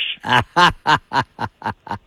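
long run of laughter at own joke; hearty laugh. Real, not acted. Angler cracks up at his own fish story, real laughter. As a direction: laugh it out after the punchline.

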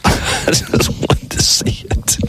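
slow, breaking up with laughter; laughing while talking. Real, not acted. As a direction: laugh through the words. Laughing at his own eagerness.

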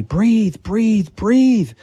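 high urgent yell of breathe. Retold or imitated. Man yelling at his own lifeless body, high urgent yell. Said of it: "So I'm yelling at my body".

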